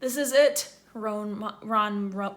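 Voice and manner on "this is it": moaning. Acted. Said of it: "moaned as they pushed".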